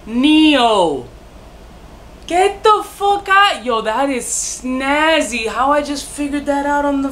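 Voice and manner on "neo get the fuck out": amazed realization. Real, not acted. Reviewer discovers the Neo and Matrix link, high and amazed. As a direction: stretch it out, astonished.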